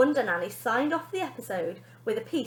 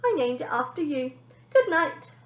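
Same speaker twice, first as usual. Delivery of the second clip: lightly voiced cheeky boy; 5 semitones higher, 4 dB louder than usual. Benji voice.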